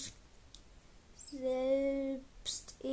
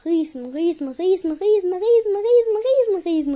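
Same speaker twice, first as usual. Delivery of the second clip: building, louder and higher with each riesen; excited shouting; 6 semitones higher, 18 dB louder, much faster than usual. Real, not acted. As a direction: hype it up, shouting riesen over and over. Hyped outro, shouting repeated word.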